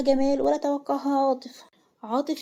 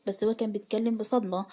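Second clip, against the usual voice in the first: plain reading voice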